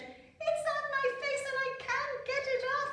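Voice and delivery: very high, loud wailing princess; distressed princess. Acted. Princess tells her mother, high distressed wail. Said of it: "Princess Prunella said".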